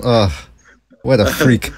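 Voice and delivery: ordinary talk, nothing marked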